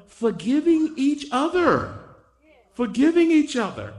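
high, ringing proclamation; emphatic preaching. Real, not acted. Preacher proclaims the verse to the congregation, high and emphatic. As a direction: drive it home, pitched well up.

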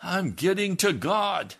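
high, loud, slow shout; reenacted fervent yell. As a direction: holler it from inside the wagon. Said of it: "Jim yelled out".

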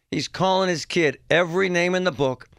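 normal talking voice

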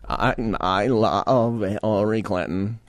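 slow, low, strained impression with drawn-out words; RFK. Host mimics the clip just played, slow mocking impression. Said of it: "in case I was mimicking it too perfectly".

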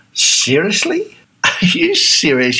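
incredulous, slow and mocking, with a laugh; sceptical Australian. Australian friend hearing about the dog groomer plan, incredulous scoffing. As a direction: scoff at the idea in disbelief. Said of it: "So the Australian response is".

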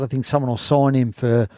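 normal talking voice

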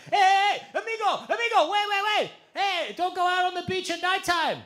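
put-on high, loud shouted warning in a Colombian accent; yelling Colombian accent. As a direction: call after them in a Colombian accent. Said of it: "Eduardo being like".

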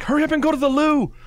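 high, quick put-on British voice; mock British accent. Co-host as mum hurrying daughters, mock British accent. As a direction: imitate a hurried British mum. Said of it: "speaking with a British accent".

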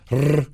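trilled Rrr, tongue tip fluttering; rolled r. Shown as a demonstration. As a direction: roll the Rrr like a motor. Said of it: "Try this".

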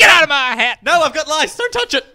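very high, loud hammed-up yelling; mock panicked shout. Co-host playing the hat owner, mock shrieked warning. Acting out woman guarding her hat.

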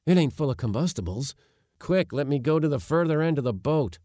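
a touch of panic, wanting to get away; mild alarm. A narrator's reading. Nephew fearing combustibles in the boat, mildly alarmed.

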